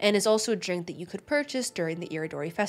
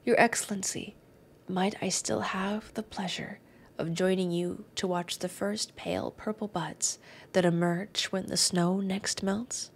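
poetic reading; 4 dB quieter than usual. Acted. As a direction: read it gently, lingering on the phrases. Reads the weapon story aloud.

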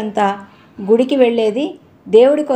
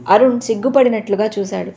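ordinary delivery, nothing marked

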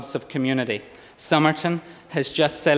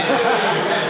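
amused laugh; 8 semitones higher than usual. Real, not acted. Laughs at his own joke.

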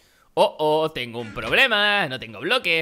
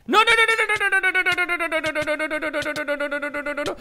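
high frantic string of no's that fades as the danger passes; panicked no no no; 11 semitones higher than usual. Real, not acted. Gamer about to be knocked off the map, panicked high no's. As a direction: beg the game not to let you fall, then run out of breath.

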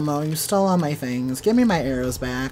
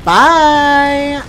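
high laughing shout of goodbye; gleeful yell; 11 semitones higher, 15 dB louder than usual. Real, not acted. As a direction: yell it out, mocking. Player taunts enemy while fleeing.

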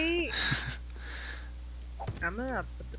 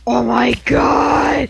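slow, loud drawn-out cry; terrified shout; 6 semitones higher, 10 dB louder, much slower than usual. Real, not acted. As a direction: wail it in despair. Near death in game.

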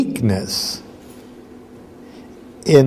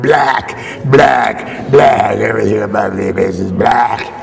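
distorted abyss voice; 4 semitones lower than usual. Acted. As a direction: growl it out like a warped echo from below. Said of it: "it's finally going".

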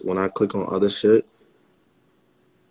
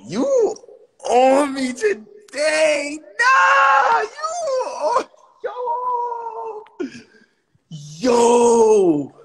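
very high, drawn-out wail; long screeching exclamations; hollering in disbelief; much slower than usual. Real, not acted. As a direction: stretch each word into a high-pitched holler. Streamer hollers in excited disbelief.

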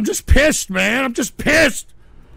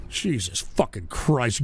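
ordinary talk, nothing marked

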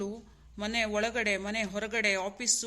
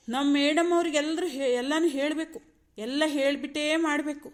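raised, higher mocking complaint; sarcastic scolding; 4 semitones higher, 4 dB louder than usual. Husband mocks madam needing everything explained, sarcastic. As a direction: needle her with exasperated mock respect.

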